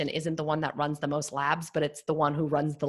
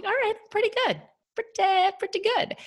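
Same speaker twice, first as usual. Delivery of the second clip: much higher, lilting mock-cocky voice; smug self-satisfaction. Clinician mocking her cocky intermediate self, high and smug. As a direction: play it pleased with yourself, high and sing-song.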